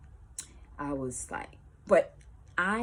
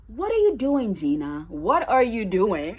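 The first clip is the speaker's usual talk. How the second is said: pointed demand; 5 semitones higher, 9 dB louder than usual. Retold or imitated. Staring down the girl.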